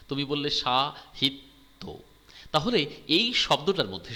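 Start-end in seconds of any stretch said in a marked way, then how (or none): 0.5-1.8 সাহিত্য drawn out slowly, syllable by syllable; teacher breaking সাহিত্য into its syllables. Shown as a demonstration. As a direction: say সাহিত্য slowly so each syllable stands apart. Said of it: "এই সাহিত্য শব্দটা একবারে উচ্চারিত হলো না".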